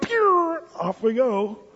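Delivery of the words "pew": high whooshing pew; zoom sound effect. Acted. Believer zooming away with the money, high pew effect. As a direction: mimic someone shooting off. Kid zooms off after getting money.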